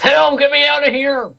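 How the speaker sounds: shrill high goblin scream; panicked trapped goblin shrieking; goblin cry for help. Acted.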